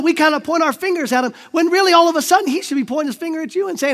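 usual speaking voice

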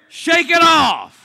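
loud, slow, high shout; preached shout. Real, not acted. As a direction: drive it home to the congregation. Preaching climax.

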